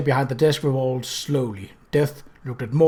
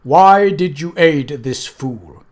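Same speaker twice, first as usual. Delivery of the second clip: slow, louder menacing voice of Death; Death, cold and menacing; 3 semitones higher, 7 dB louder, slower than usual. Acted. Death confronts the intruders, slow and menacing. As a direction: loom over them with every word.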